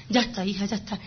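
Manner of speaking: a hushed, tender "ya está" from a mother; soothing mother. Retold or imitated. A mother soothes her daughter at the sink, softly and tenderly. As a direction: soften it like a mother comforting her sick child.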